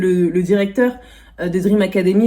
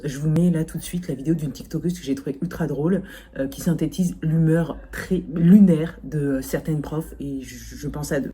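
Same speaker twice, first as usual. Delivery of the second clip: ordinary talk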